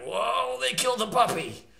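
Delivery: ordinary delivery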